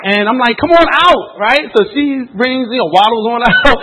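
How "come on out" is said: high, loud holler to come outside; big retold shout to the wife; calling out. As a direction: call across the parking lot, acted.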